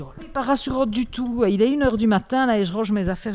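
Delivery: spooked late-night radio host recalling her fright; retold alarm. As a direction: relive the nervous panic at one in the morning, loud and high. Retold fright alone at 1am.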